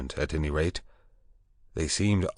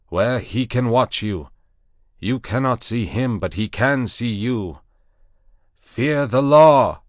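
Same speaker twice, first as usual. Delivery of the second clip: high, loud commanding proclamation; proclaiming loudly; 6 semitones higher, 7 dB louder than usual. Acted. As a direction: lift the voice over the beasts. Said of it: "lifted up my voice".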